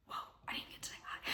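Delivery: tiny, barely audible whisper; hushed, rushed aside; stunned whisper. Retold or imitated. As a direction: whisper it fast, as if caught off guard. Taken aback, aside to herself.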